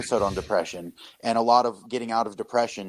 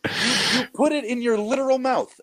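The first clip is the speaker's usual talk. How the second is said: excited emphasis; 12 semitones higher than usual. Real, not acted. Podcast guest marvels that bread and cup are material, excited and high-pitched. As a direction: voice leaping high in amazement.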